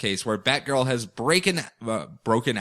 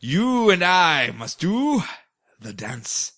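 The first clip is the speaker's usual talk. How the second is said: slow dramatic hero voice, pauses between words; El Gaucho; 3 semitones higher, slower than usual. Retold or imitated. El Gaucho challenging Scorpiana, slow grand pauses. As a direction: declaim it grandly. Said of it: "he's like".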